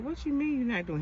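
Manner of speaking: mock indignant high-pitched comeback. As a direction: squeak out the outrage. She retorts in indignant high voice.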